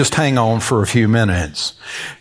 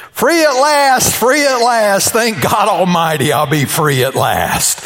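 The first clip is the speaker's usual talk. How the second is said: gleeful widower; 9 semitones higher than usual. Retold or imitated. Preacher voices a grinning widower celebrating, high gleeful crowing. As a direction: crow it like a man just set free. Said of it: "He's going".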